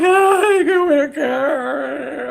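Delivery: high, loud, slow hammed-up gush; squealing with mock delight; mock excited squeal. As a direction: play the overjoyed contestant. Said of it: "I was like".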